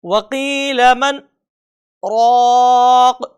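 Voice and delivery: brief breathless stop after مَنْ, then a long drawn رَاقٍ; slow chant with a held saktah; pause without breath. Shown as a demonstration. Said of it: "تو کیسے پڑھیں گے؟".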